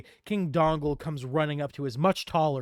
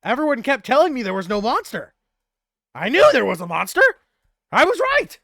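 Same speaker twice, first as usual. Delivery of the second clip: loud and high, climbing to a triumphant peak; I told you so, shouted; triumphant vindicated cry; vindicated outburst; 9 semitones higher, 10 dB louder than usual. Acted. NPC triumphant about the monster.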